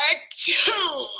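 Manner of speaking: loud acted sneeze; a big high achoo.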